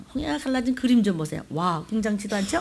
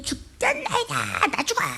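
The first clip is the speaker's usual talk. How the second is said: whining panic; 10 semitones higher than usual. Retold or imitated. Preacher mocking the panicked disciples, whiny.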